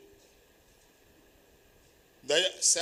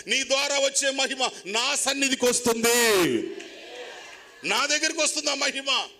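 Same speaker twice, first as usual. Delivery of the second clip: higher, louder, repeating with rising force; fervent preaching as God; 6 semitones higher, 3 dB louder than usual. Acted. Preacher speaking as God to the believer, fervent and building. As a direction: proclaim it as God's own promise, building.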